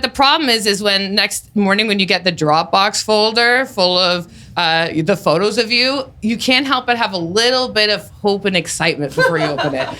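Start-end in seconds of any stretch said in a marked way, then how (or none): none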